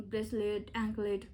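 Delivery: usual speaking voice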